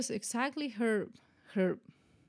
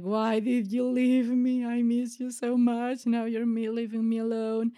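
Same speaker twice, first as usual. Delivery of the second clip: louder, raised-pitch grieving plea; retold tearful yelling; 3 semitones higher, 4 dB louder than usual. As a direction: re-enact yelling at someone who has left you. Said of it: "I started yelling".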